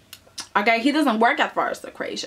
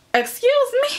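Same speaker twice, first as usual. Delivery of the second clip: squeaky, much higher mock indignation; outraged excuse me; 14 semitones higher than usual. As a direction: play shocked offence. Acted outraged reaction.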